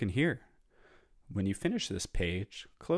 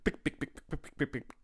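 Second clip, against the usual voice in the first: quick voiceless heel clicks, getting higher; running heels; 6 semitones higher than usual. Retold or imitated. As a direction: rattle them off like hurried steps. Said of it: "The lady starts to run".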